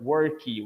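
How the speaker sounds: "worky" in two syllables, ending in ee; added final vowel. Shown as a demonstration. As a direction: add an ee after the k in "worky". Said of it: "when you add this, uh, vowel".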